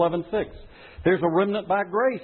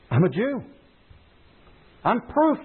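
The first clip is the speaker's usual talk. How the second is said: voicing Paul, higher and eager; Paul speaking; 7 semitones higher than usual. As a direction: speak as Paul, proud and insistent. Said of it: "says Paul".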